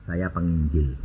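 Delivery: low, soft, matter-of-fact reply; understated answer. Retold or imitated. Preacher telling a car seller his job, understated. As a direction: say it low and quietly.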